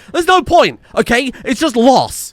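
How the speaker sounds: high, loud protest; exasperated outburst. Real, not acted. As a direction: throw up your voice in frustration. Fed up with failing firefighters.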